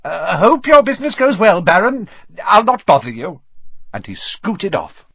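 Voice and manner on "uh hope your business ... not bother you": high, loud, nervous politeness; servile farewell. A narrator's reading. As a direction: back away with fawning good wishes. Peeves hurrying away from Baron.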